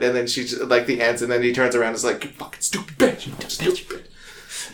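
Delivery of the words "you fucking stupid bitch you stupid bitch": hissed, whispered mock insult; under-breath insult. Charlie muttering insults at Dee as he turns away.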